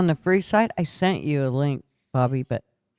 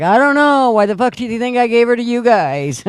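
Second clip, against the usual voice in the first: loud, high exasperated outburst; exasperated shout; 8 semitones higher, 7 dB louder than usual. Retold or imitated. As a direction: yell it in disbelief. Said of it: "And Marvin's like".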